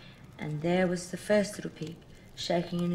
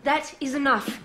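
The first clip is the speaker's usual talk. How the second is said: scolding; 7 semitones higher, 7 dB louder than usual. Acted. Mother scolding child.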